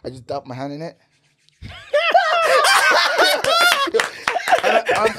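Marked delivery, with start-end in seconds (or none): 1.6-4.6 high, very loud burst of laughter; shrieking laughter. Real, not acted. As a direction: crack up in a long shrieking laugh. Hosts crack up at the story.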